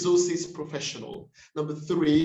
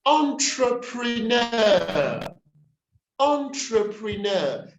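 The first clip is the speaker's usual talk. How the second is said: entrepreneur slow and high, on-truh-pri-NEUR; last-syllable stress; 6 semitones higher, 3 dB louder, slower than usual. Shown as a demonstration. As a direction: stretch entrepreneur out, leaning on NEUR. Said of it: "primary stress is on the last syllable".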